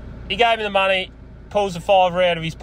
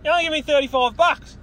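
calling after him; 9 semitones higher than usual. Retold or imitated.

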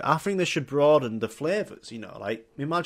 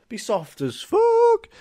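mock high voice; slower than usual. Real, not acted. Joking about yoghurt skin.

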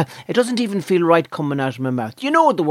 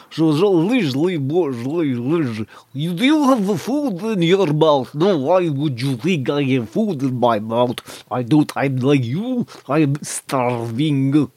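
Eastern European accent through unseparated teeth; slower than usual. Shown as a demonstration. Starving man hiding food from Liz, mouth-closed Eastern European accent. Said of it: "almost do it without, uh, separating your teeth".

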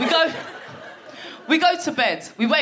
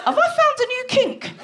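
high mock wonder; 12 semitones higher than usual. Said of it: "I thought".

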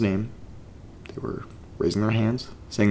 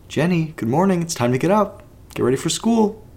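higher, louder sing-song mock mom voice; imitating mom; 8 semitones higher, 5 dB louder than usual. Teen mimics her mom's daily wake-up call, higher and louder. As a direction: chirp it like an overbright mother. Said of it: "and be like".

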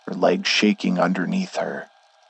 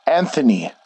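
higher-pitched call of alarm; shouted name; 6 semitones higher, 3 dB louder than usual. A narrator's reading. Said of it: "I shouted".

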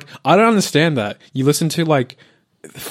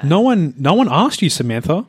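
loud, raised rant at critics; 5 semitones higher, 4 dB louder than usual. Real, not acted. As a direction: call them out loudly. Ranting at critics.

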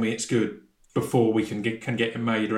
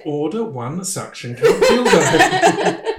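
slow, over-enunciated fake posh English order; mock posh slow; 3 semitones higher, 4 dB louder, much slower than usual. Impressing Alexa with a slow mock posh English accent. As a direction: drag each word out in a put-on posh English voice. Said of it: "Speaking posh to Alexa to try and impress".

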